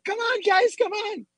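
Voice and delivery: high-pitched mock urging; excited beckoning. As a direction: imitate an eager friend waving the group over. Acting out friend beckoning the group.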